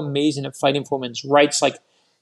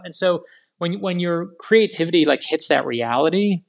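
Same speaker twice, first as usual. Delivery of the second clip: ordinary talk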